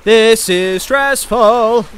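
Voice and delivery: loud, slow, drawn-out wail; stressed outburst. Real, not acted. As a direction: stretch each word out loudly. Stressed by the game.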